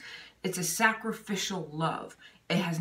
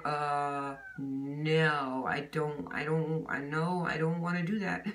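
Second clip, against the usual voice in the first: low, softened, dragging reluctance; mock reluctant mumbling to oneself; reluctant refusal; 3 semitones lower, 3 dB quieter, slower than usual. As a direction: balk half-heartedly, pitched down and hesitant. Acting out own reluctance to obey.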